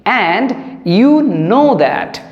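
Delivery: very slow, each word set apart; slow teaching read. Shown as a demonstration. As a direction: say it slowly for the class. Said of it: "আর তুমি সেটা ভালোভাবেই জানো".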